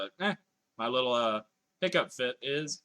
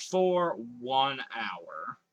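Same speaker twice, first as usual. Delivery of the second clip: dragged out; 2 semitones lower, much slower than usual. Real, not acted. Announcing milestone slowly.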